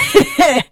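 high mocking chuckle; acted elderly snicker; old man's cackle. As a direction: cackle briefly as a scornful old man. Great-grandfather cackles after complaint.

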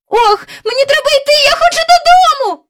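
put-on fast, very high, loud girl's cry. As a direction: cry out urgently, pitch way up. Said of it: "закричала Джейн".

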